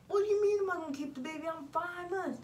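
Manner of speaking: high, fast disbelief; shocked. Retold or imitated. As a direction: say it like you can't believe it. Shocked reply to doctor.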